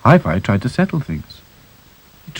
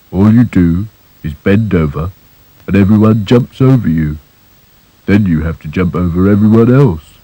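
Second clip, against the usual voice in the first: gloomy moaning; 4 dB louder than usual. Acted. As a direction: grumble it like a mopey doll. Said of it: "said Sad Sack".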